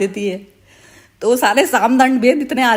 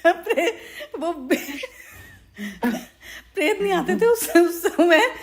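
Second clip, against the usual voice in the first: talking through real giggles, pitched up; laughing; 5 semitones higher than usual. Real, not acted. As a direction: keep breaking into laughter mid-sentence. Cracks up explaining fake possession.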